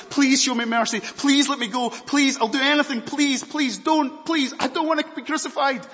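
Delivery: high, loud pleading cries; loud begging. Acted. Condemned prisoner loudly begging Pilate for mercy. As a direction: beg for your life, loud and high.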